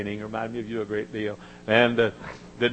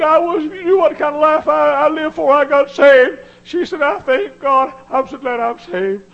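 very high, loud tearful cry; tearful woman; 12 dB louder than usual. Retold or imitated. Preacher voicing a crying lady, high and tearful. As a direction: wail it out like she's weeping with joy. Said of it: "she began to cry".

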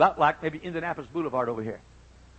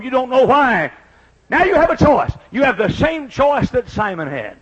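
raised, loud, insistent sermon delivery; full-force preaching; 7 semitones higher, 8 dB louder than usual. Acted. Preacher urging burdened people to accept like Simon, full-force preaching.

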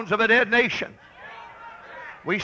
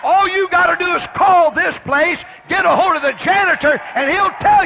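raised, insistent pulpit voice; forceful preaching; 8 semitones higher, 5 dB louder than usual. Real, not acted. Preacher telling people to call the janitor, forcefully. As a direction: drive it home to the congregation, high and forceful.

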